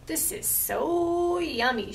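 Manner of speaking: high, slow, savoring witch voice. Acted. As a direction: relish it like a witch. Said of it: "she said".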